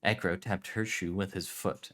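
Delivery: usual speaking voice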